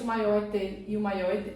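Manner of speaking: ordinary delivery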